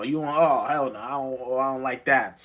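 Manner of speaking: ordinary talk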